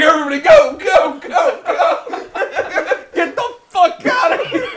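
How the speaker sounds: high, loud laughing jump-master yell; urgent repeated commands breaking into laughter; shouting sergeant. Retold or imitated. Imitating sergeant sending men out of a plane, hammed-up shouting. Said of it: "like that fucking sergeant when they're jumping".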